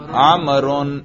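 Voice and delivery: "عمرن" pronounced with an -un case ending; nominative ending. Shown as a demonstration. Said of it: "اس کے رفع پہ تلفظ کرو".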